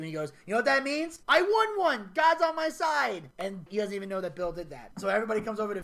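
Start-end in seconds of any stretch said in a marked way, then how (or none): 0.5-3.3 mock-triumphant shout, stretched at the end; triumphant boast. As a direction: crow it like a winner, rising in pitch. Acted gloating victory cry.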